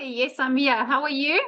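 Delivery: ordinary delivery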